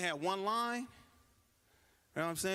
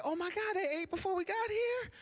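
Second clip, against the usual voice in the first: high, shocked gasp of a question; high-pitched mock outrage; shocked lady; 14 semitones higher than usual. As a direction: play the scandalized lady, voice shooting up. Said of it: "Lady was like".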